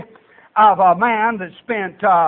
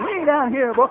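normal talking voice

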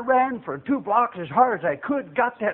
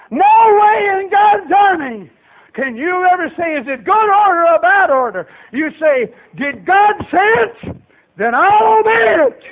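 raised, loud pulpit delivery; impassioned preaching; 9 semitones higher, 6 dB louder than usual. Real, not acted. Preacher tells church of God making soldiers, forceful exhortation. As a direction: preach it with force, building to a cry.

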